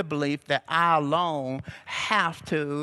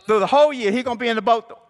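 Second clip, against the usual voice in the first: louder, higher preaching; building preaching; 6 semitones higher, 6 dB louder, much faster than usual. Real, not acted. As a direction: lift it up for the church.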